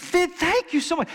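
high, stammering mock-overwhelmed thanks; imitated near-tears gratitude. Student thanking him for candy, imitated as tearfully grateful. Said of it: "students were on the verge of tears".

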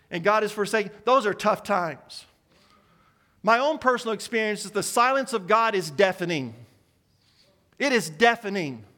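ordinary delivery

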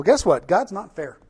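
ordinary talk, nothing marked

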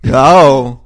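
loud, slow, hammed-up theater-camp delivery; over-the-top child actors. Mocking the campy children actors, hammed up and loud. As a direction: overact it like a camp kid on stage. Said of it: "how over the top the children actors were".

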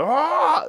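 high, loud sustained "ah" cry; mock scream. Chad pretending the spell works, mock screaming. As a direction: let out a loud, high fake shriek. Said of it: "he starts doing the".

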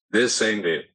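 ordinary delivery

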